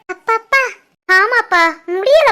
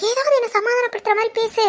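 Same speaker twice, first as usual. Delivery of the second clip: hushed prompting; 2 semitones lower, 5 dB quieter than usual. Acted. Friend secretly prompts Nandini as Ram comes, hushed aside. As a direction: keep it down, coaching her under cover.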